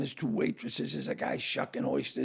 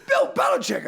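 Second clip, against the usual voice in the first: high, loud starstruck cry; excited calling out; 7 dB louder than usual. Retold or imitated. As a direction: hail a celebrity across the room. Dennis runs over calling the name.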